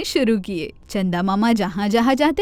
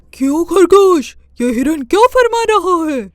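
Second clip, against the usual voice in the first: louder, higher, furious demand; angry Chanda Mama; 6 semitones higher, 6 dB louder than usual. Acted. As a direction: confront the rabbit in rage. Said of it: "چندا ماما بہت طیش میں آ گئے".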